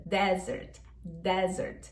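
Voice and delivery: Desert dito dézert, e aberto e forte; open e, stress first. Shown as a demonstration. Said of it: "a pronúncia é aberta". (Desert com e aberto e tônica no de)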